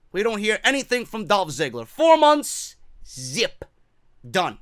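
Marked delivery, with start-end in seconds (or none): none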